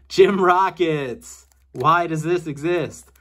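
high, loud, slow disbelief; incredulous. Real, not acted. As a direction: drawl out the disbelief. Incredulous at odd game.

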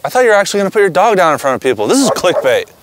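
fast, annoyed viewer rant; angry viewer. Retold or imitated. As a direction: rattle off a peeved complaint. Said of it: "Viewers are like".

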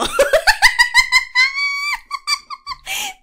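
very high, loud burst of laughter; helpless laughing fit; loud shrieking laugh. Real, not acted. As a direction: cackle uncontrollably. Cracks up again.

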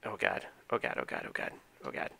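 breathless, whispered panic; terrified whisper. Real, not acted. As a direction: whisper it in dread. Panicking as enemies seen.

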